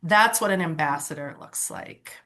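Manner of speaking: normal talking voice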